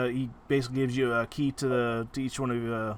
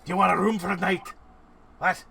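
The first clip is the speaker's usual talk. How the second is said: lightly put-on German accent, a bit louder; 5 semitones higher, 4 dB louder than usual. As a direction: innkeeper offering a room in a mock German accent. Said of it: "we'll do German".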